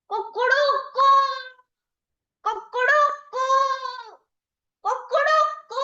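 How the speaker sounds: high, ringing crow of a rooster at dawn. A narrator's reading. Chicken at dawn crowing for the fire, wind and water. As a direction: crow like a proud rooster waking the palace. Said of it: "the chicken started crowing".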